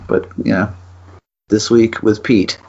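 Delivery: ordinary talk, nothing marked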